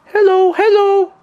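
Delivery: very high, loud drawn-out call; crazy lady yelling. Retold or imitated. Imitating the crazy lady yelling at passers-by, shrill yell. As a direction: screech it slowly, like a parrot calling. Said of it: "like, yelling at us".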